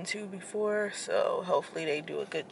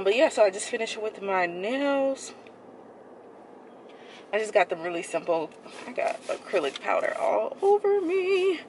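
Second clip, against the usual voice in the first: muffled behind cloth; covered mouth speech; through a face mask. Real, not acted.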